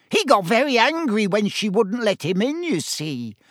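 high oily voice, dropping at the end; Peeves, oily and gloating. A narrator's reading. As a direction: tell tales with wicked delight. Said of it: "he adopted an oily voice".

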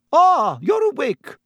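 high, loud brisk matron's greeting; brisk and high-pitched, drawn out. A narrator's reading. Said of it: "she said briskly".

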